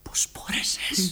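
slow, whispered, no voice; shocked whisper. Acted. Son to his father, a slow shocked whisper. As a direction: barely get the words out.

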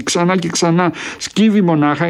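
ordinary delivery

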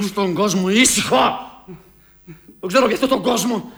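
loud, high-pitched rebuttal; angry outburst. Acted. Son cutting down his father's excuse, angry outburst.

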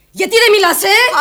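high, loud challenge; angry demand. Acted. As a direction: push hard for an answer. Daughter demands mother's answer. (οργισμένη, δυνατή απαίτηση)

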